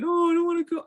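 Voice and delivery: high-pitched imitated toddler whine; protesting toddler. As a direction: mimic a small child refusing to leave. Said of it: "he was just like".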